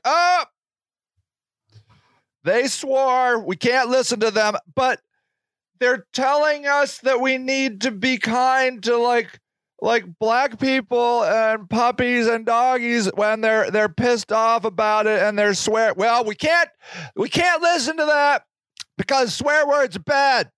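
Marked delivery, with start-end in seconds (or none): 0.0-4.5 mock prudish parent. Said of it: "You're gonna be like". 15.9-20.5 mock prude. Mocking prudish parents.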